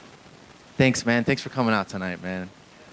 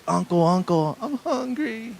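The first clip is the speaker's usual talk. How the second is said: put-on high, small whimpering kid voice; imitated crying child; 10 semitones higher, slower than usual. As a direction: whine it like a hungry little boy. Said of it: "he's crying".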